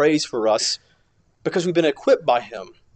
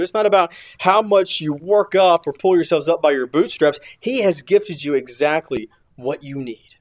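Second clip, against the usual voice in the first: usual speaking voice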